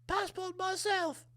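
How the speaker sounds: high, soft, frail old Queen impression. The Queen handing over a passport, frail and high. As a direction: quaver it out like an elderly queen. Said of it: "she comes shuffling in".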